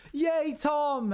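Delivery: high, cheering whoop; party cheer. Acted. Friends throwing Tom a party, high cheer. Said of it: "We just go".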